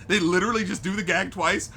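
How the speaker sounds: ordinary talk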